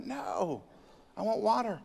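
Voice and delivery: a thirsty man too dry to speak up; parched and pleading. Retold or imitated. Man in the desert refusing a tie, faint and parched. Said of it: "he was getting rather parched".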